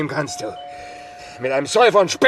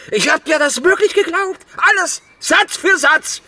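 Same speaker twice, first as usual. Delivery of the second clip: outraged; 11 semitones higher, 4 dB louder than usual. Acted. Angry at being lied to.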